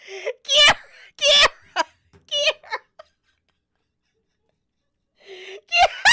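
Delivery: high, loud laughing, calling the name; laughing too hard to go on; helpless laughter. Real, not acted. Cracking up at Kiera blowing herself up, laughing.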